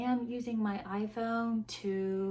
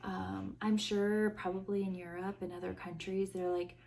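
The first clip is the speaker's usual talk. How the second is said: ordinary talk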